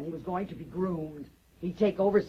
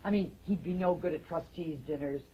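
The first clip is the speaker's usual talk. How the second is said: low, soft, needling; subdued, cutting scorn; cool contempt; 4 semitones lower, 6 dB quieter than usual. Acted. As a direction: belittle him quietly after the blow-up. Wife coolly needles husband after smash.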